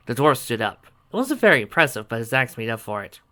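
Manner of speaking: plain reading voice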